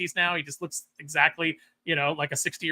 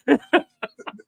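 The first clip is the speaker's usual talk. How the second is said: old man laugh imitation; 9 semitones higher, 7 dB louder than usual. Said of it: "Abe's like".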